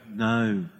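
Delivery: low, deadpan mock reply; audience verdict. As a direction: pronounce the verdict dryly. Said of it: "the whole audience go".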